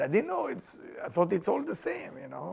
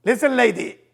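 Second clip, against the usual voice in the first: louder, indignant scolding; louder angry stranger voice; upset man; 4 semitones higher, 9 dB louder than usual. Retold or imitated. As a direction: put the lady in her place. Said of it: "one guy get upset with her".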